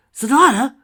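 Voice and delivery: high, loud startled exclamation; startled cry. A narrator's reading. Sunset recognising Sonata on the phone, startled cry. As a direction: yell the name in high-pitched shock.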